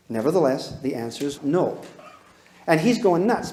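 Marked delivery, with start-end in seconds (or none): 0.1-1.8 calm firm no. Shown as a demonstration. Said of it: "You say".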